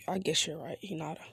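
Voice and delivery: soft whispered concession. Acted. Naruto quietly admits Hinata is right, whispering. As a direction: breathe it out, barely voiced.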